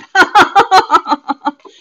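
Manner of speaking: loud high burst of laughter; a big real laugh at the viewer. Real, not acted. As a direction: laugh out loud while teasing. Teasing a viewer about okra.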